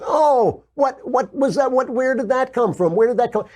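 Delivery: a pundit mocks the stunned media in a shrill, hammed-up voice; mock surprise. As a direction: play fake innocent shock, pitch way up. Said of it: "And it's like".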